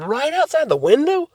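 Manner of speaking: high, rising mock disbelief; surprised question. Retelling Daddy's question about the window, surprised. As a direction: ask it high, rising sharply at the end.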